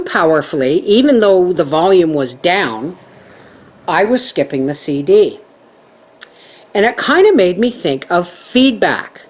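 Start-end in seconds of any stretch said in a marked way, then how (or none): none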